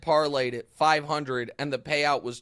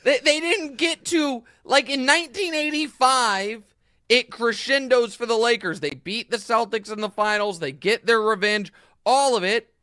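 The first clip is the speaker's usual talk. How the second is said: loud, high, wound-up ranting; excited rant; 7 semitones higher, 6 dB louder than usual. Real, not acted. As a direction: keep ranting, thrilled and indignant. Continues gleeful rant.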